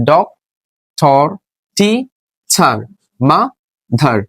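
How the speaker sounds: Doctor, টি ছাড়, মা, ধার broken into separate syllables with pauses. Shown as a demonstration. Teacher showing pupils the two-syllable words, syllable by syllable. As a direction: sound out doctor, টি ছাড়, মা, ধার slowly, a gap after each syllable.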